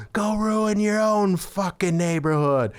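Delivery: high, drawn-out mock yell; yelled from window. Neighbor to vandal teens from a window, retold yell. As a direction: imitate an angry neighbor calling out, without full volume. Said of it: "someone yelled from a window".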